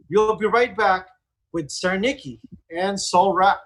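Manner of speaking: normal talking voice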